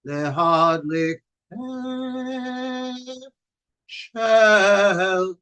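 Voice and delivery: רב מברך על הנרות בניגון; candle blessing. Acted. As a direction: chant the Shabbat candle blessing.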